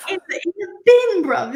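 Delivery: high, loud mock British quote; hammed-up London accent. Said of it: "In the words of Simon".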